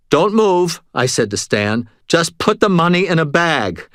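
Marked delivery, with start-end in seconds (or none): 0.1-0.8 commanding. A narrator's reading. Robber orders cashier. 2.1-3.9 ordering. A narrator's reading. Robber orders cashier.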